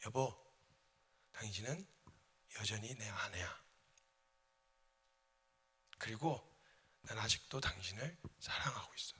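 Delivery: low, soft, whispered love; husband softly tells his wife he still loves her; tender confession. Acted. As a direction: say it gently to the wife.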